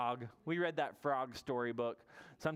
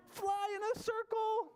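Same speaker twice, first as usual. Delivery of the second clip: much higher, slow sing-song; slower than usual. Shown as a demonstration. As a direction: stretch it up high and slow. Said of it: "Can you fly in a circle?".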